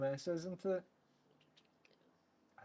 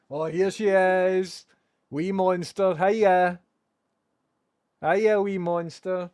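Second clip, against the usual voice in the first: excited greeting; 4 semitones higher, 9 dB louder than usual. Real, not acted.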